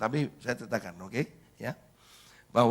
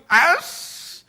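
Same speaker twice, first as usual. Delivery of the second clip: loud, high scoffing hiss; mock exasperated brush-off; dismissive parent; 8 dB louder than usual. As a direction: cut the child off impatiently. Parent brushes the child off again.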